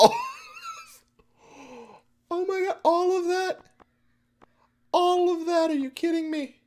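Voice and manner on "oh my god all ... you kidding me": very high-pitched wail; real high, squeaky dismay; anguished disbelief. Real, not acted. Wailing over lost gold and items.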